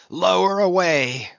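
loud, high shouted order; cried command. A narrator's reading. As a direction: call it out forcefully to the boat hands. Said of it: "cried Ahab".

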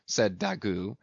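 ordinary delivery

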